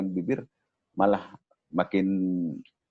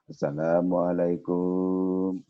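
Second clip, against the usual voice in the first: street beggar greeting; much slower than usual. Shown as a demonstration. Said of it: "Biasanya di lampu merah".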